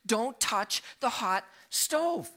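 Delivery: slow, word-by-word warning, pitched high; retold parental command. Father warning his son about the stove, slow retold command. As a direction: spell out one firm command to a child. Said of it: "I gave him one command".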